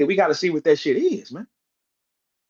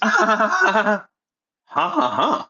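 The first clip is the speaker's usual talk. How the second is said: imitated ha-ha laugh; mock laugh; 4 semitones higher, much slower than usual. Mimicking rival's reaction to being called out, mock laugh. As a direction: imitate his empty laughing.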